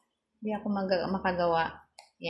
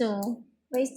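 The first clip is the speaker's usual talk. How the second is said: ordinary talk, nothing marked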